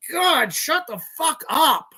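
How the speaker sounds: high, drawn-out irritation; exasperated outburst. Real, not acted. Ranter fed up with an insurance ad, exasperated. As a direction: tell them off slowly, pitched right up.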